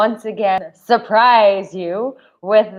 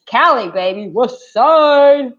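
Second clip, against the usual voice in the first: higher, louder West Coast hype call; hype shout-out; 6 semitones higher, 5 dB louder, slower than usual. Pretend. Hyping up California viewers, loud and high.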